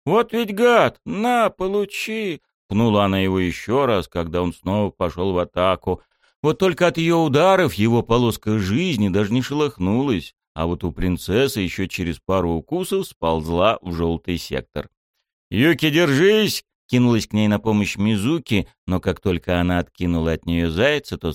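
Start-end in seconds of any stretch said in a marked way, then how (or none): 0.0-2.4 very high, furious kicks; high indignant yell; angry cartoon princess. A narrator's reading. 15.5-16.6 urgent call. A narrator's reading. Rushing to help.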